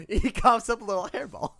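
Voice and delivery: laughing through the words; cracking up while he describes it. Real, not acted. GM cracking up describing the hairball, laughing.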